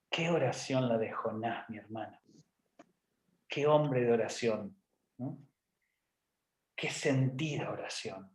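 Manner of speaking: soft, low, reverent awe; hushed admiration. Pretend. Preacher marvels at Jonah's prayer, hushed and reverent. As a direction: let each phrase sink away in mock awe.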